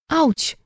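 sharp high yelp of pain; ouch of pain. A narrator's reading. Pia yelping as Hanna brushes her tangled hair.

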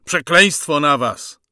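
loud, high shouted curse; young prisoner's last outcry; defiant cry. A narrator's reading. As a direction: hurl a curse at the firing squad. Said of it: "zawołał w tej samej chwili młodszy".

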